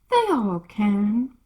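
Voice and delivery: a hint of a haughty duchess, slow; lightly voiced Duchess. Duchess answering Alice, lightly haughty. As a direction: drawl it out a little grandly.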